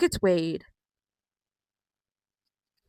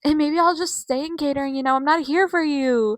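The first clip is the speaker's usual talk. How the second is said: higher-pitched mock brush-off; 5 semitones higher, 4 dB louder than usual. Vlogger mimicking A J dismissing Becky, mild mock retort. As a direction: lightly imitate a dismissive wrestler. Acting out A J's dismissive reply.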